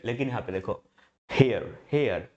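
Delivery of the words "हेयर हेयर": हेयर said slowly, gliding from e to a, a little louder; demo pronunciation. Shown as a demonstration. Teacher demonstrating a diphthong to students, exaggerated glide. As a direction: slide through हेयर to show the tongue moving. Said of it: "जीभ हेयर".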